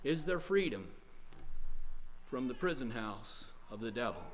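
low-key grave question, dropping soft at the end; subdued questioning. Real, not acted. Preacher posing tonight's question, subdued. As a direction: pose it quietly, with a pause midway.

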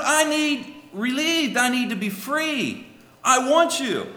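high, loud desperate pleading prayer; pleading cry to God. Acted. Trapped sinner begging the Lord for freedom, loud desperate plea.

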